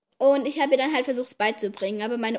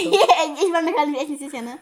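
laughing; 3 semitones higher, 4 dB louder than usual. Real, not acted. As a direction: get the words out through laughter. Laughing at grandpa's age. (lachend gesprochen)

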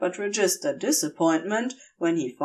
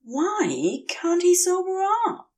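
a woozy angel asking in bewilderment; dazed angel, confused; 8 semitones higher than usual. Acted.

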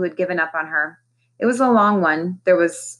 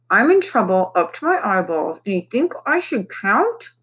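through her teeth; 3 semitones higher than usual. A narrator's reading. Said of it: "talking through her teeth".

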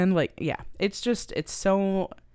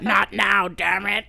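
mock angry dad; slower than usual. Dad snaps at kid in reenactment.

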